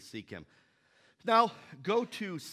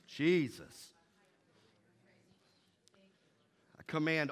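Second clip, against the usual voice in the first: commanding, intense prayer; fervent prayer. Acted. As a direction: pray over the room with authority. Leads congregation in prayer.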